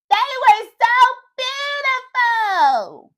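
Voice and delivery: high, loud, stretched-out delight; very excited. Shown as a demonstration. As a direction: gush it with huge excitement. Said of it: "Can you say that with me".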